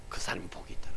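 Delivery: whispered, letting the point land softly; hushed answer. Real, not acted. Preacher answering his own question to the congregation, hushed. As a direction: drop to a near whisper.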